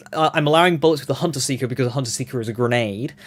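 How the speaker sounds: ordinary talk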